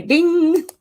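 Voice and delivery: high ringing ding; imitated ding. Answering a viewer's apology with an imitated ding. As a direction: sing out a bell chime. Imitates a chime after sorry.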